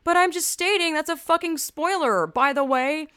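fed up with being told to calm down; irritated high rant; exasperated outburst. Retold or imitated. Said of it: "I'm like".

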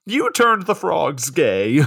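high, loud, drawn-out mock outrage; conspiracy theorist. Co-host joins the accusations, mock conspiracy rant. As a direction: stretch out a hammed-up, paranoid accusation. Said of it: "big conspiracy theorist energy".

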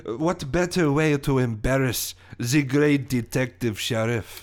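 gloating French villain; theatrical French-accented taunt. Acted. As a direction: sneer at your rival in a French accent. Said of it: "my French accent".

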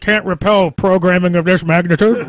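booming villain voice. Pretend. Sci-fi villain impression.

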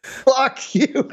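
high mock insult; playful fuck you. Echoing the mock insult, higher.

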